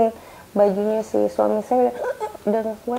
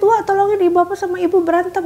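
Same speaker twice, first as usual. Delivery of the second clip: much higher, louder urgent calls; panicked call; 8 semitones higher, 4 dB louder than usual. Retold or imitated. Calling for help during the parents' fight, panicked.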